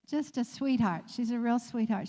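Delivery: usual speaking voice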